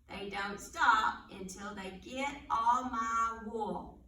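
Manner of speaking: put-on riddle read aloud for little kids; reading to children. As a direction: read it like storytime. Reads animal riddles to children.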